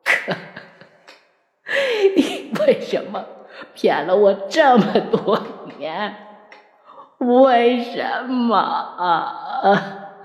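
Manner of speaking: high, broken lament through bitter laughter; crazed grief, laughing and sobbing; anguished, half-laughing. A narrator's reading. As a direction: wail the question in despair, drawing out the end. Mad laughing, anguished question to lover.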